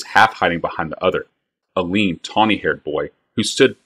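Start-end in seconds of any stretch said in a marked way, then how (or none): none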